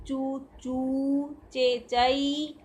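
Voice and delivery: चू चू and चे चई drawn out very slowly; very slow, held syllables; slow dictation. Shown as a demonstration. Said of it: "जैसे जैसे मैं बोल रही हूँ".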